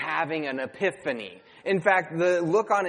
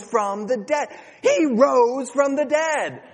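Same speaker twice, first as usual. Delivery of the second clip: put-on excited child preaching; 6 semitones higher, 4 dB louder than usual. Child preaching in back seat.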